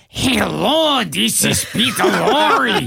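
the director demonstrating the real Lorre; Peter Lorre voice. Retold or imitated. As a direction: show him how Lorre really sounds. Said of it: "And they go, "No, no".

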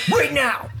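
high sharp command; stern dad voice. Retold or imitated. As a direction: snap an order at the dog. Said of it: "I go to the dog again".

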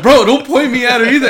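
loud, high-pitched comic plea; mock-panicked protest. Customer swivelled toward staring woman, loud mock panic. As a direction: yelp it at the barber through the laughter.

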